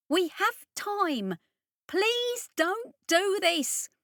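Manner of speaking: high, urgent pleading voice; pleading engineer. Acted. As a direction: beg him to stop before it collapses.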